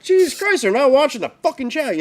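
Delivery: high, loud exasperated outburst. Retold or imitated. Streamer annoyed nobody reads the chat, high exasperated outburst. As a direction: throw up your hands at the ignored chat. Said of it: "I'm like".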